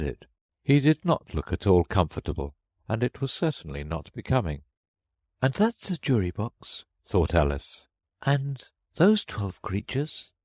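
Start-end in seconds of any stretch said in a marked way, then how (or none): 5.4-10.3 put-on higher, slower girlish voice; Alice counting the odd jurors, wondering; Alice's voice. A narrator's reading. Alice eyeing the jury box, lightly voiced girl.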